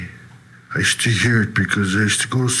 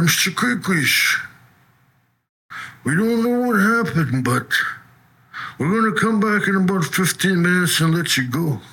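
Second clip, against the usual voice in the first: higher, bemused cop voice; a laughing officer, higher; amused cops; 7 semitones higher than usual. Retold or imitated. Police tell the jailed man he's free, in amused disbelief.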